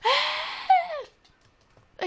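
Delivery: long high-pitched scream; sustained high yelp; startled scream. Real, not acted. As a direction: shriek out in alarm. Sudden attack, loses a life.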